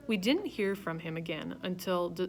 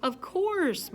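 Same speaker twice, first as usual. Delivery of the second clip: knowing; 12 semitones higher, 5 dB louder than usual. A narrator's reading. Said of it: "like he knew it all along".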